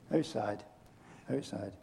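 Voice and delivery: soft, low and falling; sad lament. Real, not acted. Preacher mourning the girl's death, softly. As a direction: let it sink quietly twice. Lament over dead daughter.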